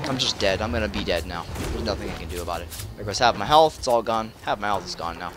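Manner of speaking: low, soft, defeated muttering; resigned deadpan. Real, not acted. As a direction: give up quietly, voice dropped. Gives up as health vanishes.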